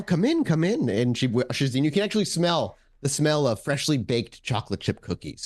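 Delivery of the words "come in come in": higher, sweet motherly welcome; smiling welcoming mom. Acted. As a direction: usher a guest in with a smile. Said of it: "looks up to Ava with a smile".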